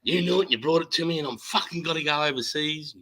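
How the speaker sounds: high, fast yell at the man who started the fight; retold angry yell. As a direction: bark it like you're still fuming. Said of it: "I said".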